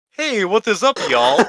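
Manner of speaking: mock Russian-Southern hybrid accent. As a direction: imitate a Russian with a Southern twang. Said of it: "stranger of a Russian and, and Southern accent".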